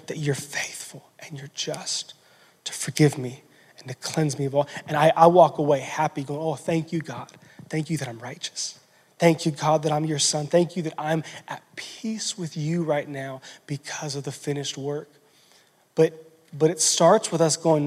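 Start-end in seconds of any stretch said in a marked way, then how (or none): 0.1-4.6 whispered. Real, not acted. Preacher whispers God's promise to the congregation, hushed. 6.4-15.1 soft, hushed thankful prayer; quiet prayer. Acted. Preacher voicing a thankful believer's prayer, softly hushed.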